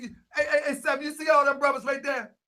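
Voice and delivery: hey Sam, loud; calling out. Real, not acted. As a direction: shout over to Sam to get his attention.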